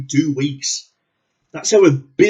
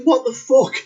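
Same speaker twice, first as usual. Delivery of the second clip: incredulous; 10 semitones higher than usual. Real, not acted. Disbelief at a stupid kill.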